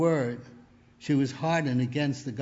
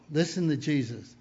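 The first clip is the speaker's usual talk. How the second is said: ordinary delivery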